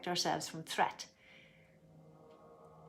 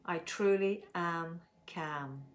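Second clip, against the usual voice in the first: slow calm affirmation; much slower than usual. Shown as a demonstration. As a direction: say it slowly, letting calm settle. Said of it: "let's make our statement".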